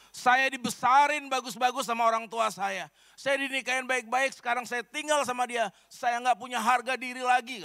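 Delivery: a wronged wife pouring out hurt, half sobbing; tearful complaint. Retold or imitated. Wife complains to the healer about her mother-in-law, high tearful whine. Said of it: "sambil nangis".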